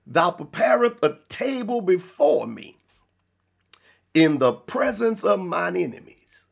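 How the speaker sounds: deliberate, spaced-out verse reading; measured scripture reading. Real, not acted. Preacher reading verse five of Psalms twenty-three to the congregation, measured reading. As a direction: read it out in weighty phrases with a long pause midway.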